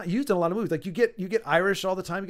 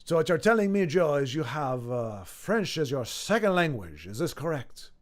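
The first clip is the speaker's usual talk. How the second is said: attempted French accent. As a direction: have a clumsy go at a French accent. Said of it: "I've never tried a French accent".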